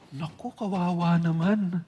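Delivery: soft, put-on sympathy; fake pity. Gossiper faking sympathy for the sick person, soft mock pity. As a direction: pretend to feel sorry. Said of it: "Kunyari, kawawa naman".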